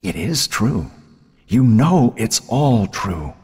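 unyielding, drawn-out menace; relentless. A narrator's reading. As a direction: press the accusation home, stretching each word. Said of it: "her sister's relentless voice".

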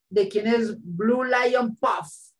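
slow, high call out across the class. Real, not acted. Teacher asking the class whose site it is, slow call out. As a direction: draw it out as you call out.

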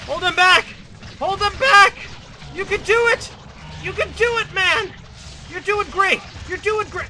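high, very loud cheering-on of the troops; battle rallying shout. Pretend. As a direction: yell encouragement over the fight. Rallying his men in battle.

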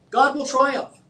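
bold proclamation. Real, not acted. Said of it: "let me boil this down to a simple statement".

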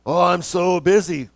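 slow mock-weary whine in a put-on voice; mock busy person. The same people answering the preacher, mock weary. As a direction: imitate a put-upon complainer. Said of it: "The same people I talk to go".

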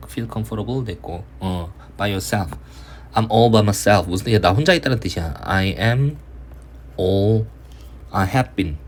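ordinary delivery

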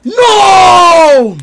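high, loud, long cry of no; drawn-out shout. Real, not acted. Streamer reacting on stream, long high shout. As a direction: yell it out, sliding down at the end.

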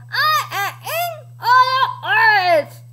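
high, garbled dinosaur boast; mangled storybook roar; Tyrannosaurus unable to talk. A narrator's reading. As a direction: force mushy words past the feathers. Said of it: "He can't talk".

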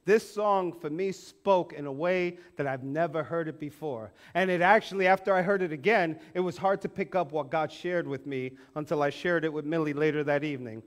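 usual speaking voice

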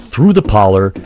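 usual speaking voice

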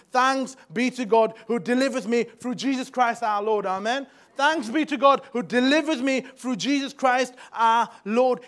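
full-force preaching; triumphant proclamation. Acted.

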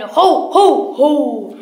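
loud, booming belly-laugh call; Santa's ho-ho-ho. Acted. Santa greets the bears who found him, booming ho-ho-ho. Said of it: "said Santa Claus".

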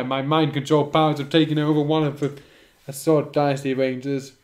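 normal talking voice

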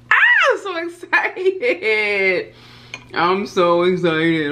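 high, drawn-out squeal of joy, slow; squealing excitement. Real, not acted. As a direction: squeal it out, barely able to wait. Overjoyed before eating the eggs.

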